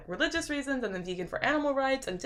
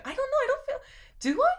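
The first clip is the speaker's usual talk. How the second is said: surprised; faster than usual. Real, not acted. Surprised by accent comment.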